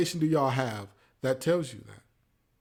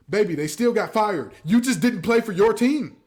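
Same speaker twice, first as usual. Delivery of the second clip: louder, higher exasperated scolding; 6 semitones higher, 6 dB louder than usual. Real, not acted. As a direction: raise your voice, fed up with him. Exasperated correction of Kyrie.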